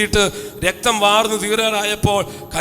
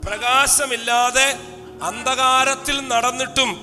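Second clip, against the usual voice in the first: "പ്രകാശമില്ലാതെ അന്ധകാരത്തിൽ നടന്നിട്ടും" drawn out word by word; slow, deliberate scripture line; slow emphatic reading; slower than usual. Shown as a demonstration. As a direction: lay each word down slowly for the congregation. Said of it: "നിങ്ങൾ ശ്രദ്ധിച്ചോണം".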